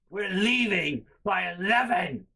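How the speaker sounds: high, slow mock yell; imitated angry mom. Mom as the argument escalates, mock-angry yelling. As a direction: drag out each word as an exasperated mother. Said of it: "so it escalates".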